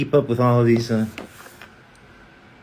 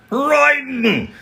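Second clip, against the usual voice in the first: high shouted character cry; lightning voice; 14 semitones higher than usual. Pretend. Fan request, the lightning voice for chat. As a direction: belt out the character's name. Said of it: "says Solidus forty-two".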